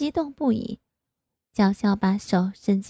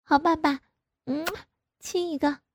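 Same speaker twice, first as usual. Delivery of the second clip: high, delighted and coy; giddy; 7 semitones higher, 4 dB quieter than usual. A narrator's reading. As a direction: bounce with happy glee. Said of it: "跳着脚说". (雀跃撒娇)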